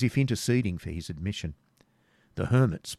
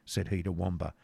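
ordinary delivery, nothing marked

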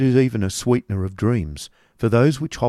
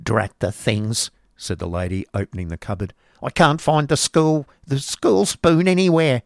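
loud, cross schoolmaster's wife; Mrs Squeers grumbling; 4 semitones higher, 3 dB louder than usual. Acted. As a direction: grumble at the cupboard. Mrs Squeers searches the cupboard.

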